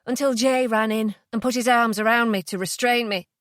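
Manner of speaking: ordinary delivery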